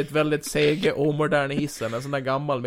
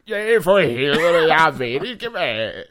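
gruff villain; 2 semitones higher, 7 dB louder, slower than usual. Acted. Mysterio speaks.

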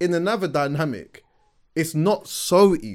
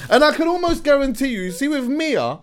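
excited; 8 semitones higher, 6 dB louder than usual. Real, not acted. High excited arguing voice.

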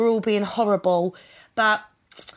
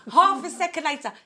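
laughing; 7 semitones higher than usual. Retold or imitated. Retelling mum's reply, laughing.

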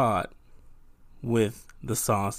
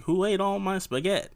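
bad Italian accent; 8 semitones higher than usual. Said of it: "with this really bad Italian accent".

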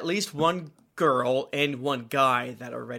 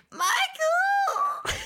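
shrill yelled name. Retold or imitated. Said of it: "the best is".

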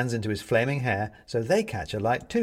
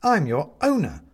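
indignant; 6 semitones higher, 7 dB louder than usual. Acted. Benedict protests to his cat.

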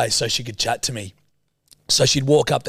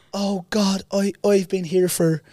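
imitated Irish woman; 5 semitones higher than usual. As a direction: mimic a gushing Irish woman. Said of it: "haven't met a single Irish person".

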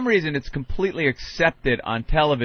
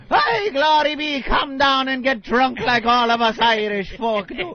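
put-on Irish accent; 8 semitones higher than usual. Mocking an Irish bar ad in an Irish accent. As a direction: hail the pub in a stage Irish accent. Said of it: "a bar with an Irish guy".

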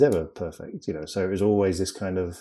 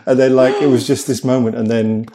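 ordinary delivery

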